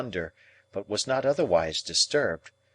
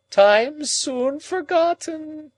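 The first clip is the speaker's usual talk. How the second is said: high, louder, very slow moan; 6 dB louder, much slower than usual. A narrator's reading. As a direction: stretch forgotten out in grief. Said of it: "moaned Miss Havisham".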